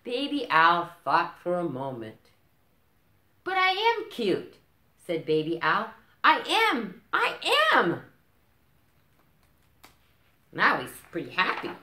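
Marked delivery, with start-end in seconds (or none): 3.4-8.0 very high, loud giggly squeal; very high, loud, gleeful little owl; delighted admission. Acted. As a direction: burst out happily, proud. Baby Owl changes mind.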